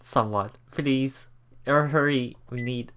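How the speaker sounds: ordinary delivery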